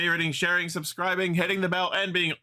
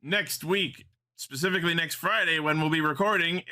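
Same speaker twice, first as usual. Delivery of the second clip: ordinary talk, nothing marked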